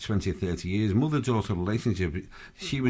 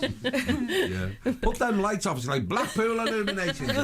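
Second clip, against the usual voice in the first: nagging mum voice; 10 semitones higher, slower than usual. Pretend. Radio host mimicking nagging mum.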